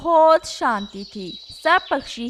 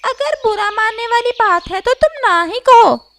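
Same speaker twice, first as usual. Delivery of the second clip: higher, louder parrot, jabbing; teasing parrot; 9 semitones higher, 9 dB louder than usual. Acted. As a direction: tease the crow sharply. Parrot teases the crow.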